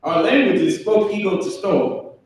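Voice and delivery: Our language is spoke ego to store said in the Hebrew-like original sounds; spoken in the original tongue. Shown as a demonstration. Teaching the class how the language sounds, foreign pronunciation. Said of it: "It's spoke like that".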